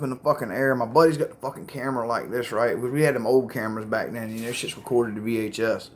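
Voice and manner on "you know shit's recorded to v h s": ordinary talk, nothing marked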